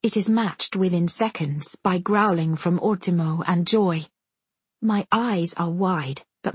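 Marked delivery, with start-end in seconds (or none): none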